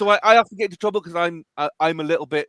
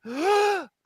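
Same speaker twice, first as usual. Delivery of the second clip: gasp. Retold or imitated. Crowd inrush as ball goes in.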